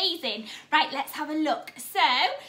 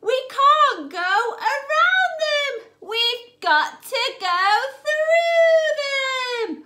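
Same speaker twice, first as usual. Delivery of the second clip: higher, louder, slow storybook drama; building dramatic refrain; 8 semitones higher, 6 dB louder, slower than usual. Acted. Teacher reading the lamb refrain to children, building slow and loud. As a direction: build the suspense, drawn out.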